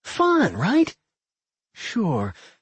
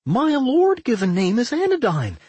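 put-on high-pitched, proud slime girl; high voice swelling with pride; proud proclamation; 13 semitones higher, 4 dB louder than usual. A narrator's reading. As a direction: announce your name grandly, pitched way up. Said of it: "the slime girl proclaimed proudly".

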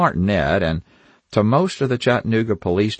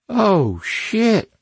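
high-pitched startled oath; alarmed; 13 semitones higher than usual. A narrator's reading. Kate answering a call after a shooting, alarmed.

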